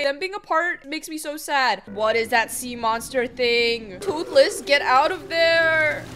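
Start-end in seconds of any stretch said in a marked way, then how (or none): none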